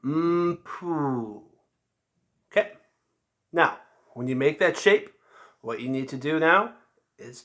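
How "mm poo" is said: "mm, poo" with pouty, pursed lips; a soft "mm" then a puffed "poo"; mm poo embouchure. Shown as a demonstration. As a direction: shape "mm, poo" slowly, lips pushed forward. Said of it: "I'll show you one more time".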